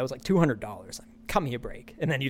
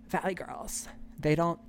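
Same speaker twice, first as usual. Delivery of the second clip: put-on Valley Girl voice; 10 dB quieter than usual. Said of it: "fake Valley Girl talk".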